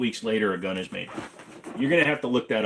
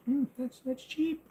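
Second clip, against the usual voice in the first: very high, small falsetto; mock squeaky reaction; 4 dB quieter than usual. Streamer reacting to a thousands-of-dollars price, mock squeak. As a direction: squeak it out as if pretending it's fine.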